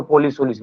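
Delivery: "पोलिस उलिस" with o in the first syllable; wrong pronunciation. Shown as a demonstration. Teacher showing failed imitation of English, mispronounced "पोलिस". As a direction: say "पोलिस" with o, not u. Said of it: "उसको सही से नहीं बोल पाए".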